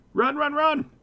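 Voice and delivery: panicked high yell. Real, not acted. Monsters close, fleeing in panic.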